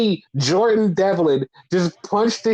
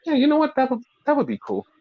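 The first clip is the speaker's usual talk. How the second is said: much higher, fast, mock-enthusiastic; sarcastic agreement; 9 semitones higher, much faster than usual. Podcaster mocking fans' idea, high, fast, mock-cheerful. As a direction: fake cheer, then let it drop. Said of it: "And I'm like".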